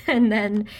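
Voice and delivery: real laugh breaking into the words; loud burst of giggles while talking. Real, not acted. Tarot reader tickled by something from yesterday, laughing. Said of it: "I'm sorry, I'm laughing".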